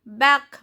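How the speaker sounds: "Bac" with the C sounded; pronounced final C. Shown as a demonstration. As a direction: say "Bac" firmly, ending on k. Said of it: "Here C is pronounced".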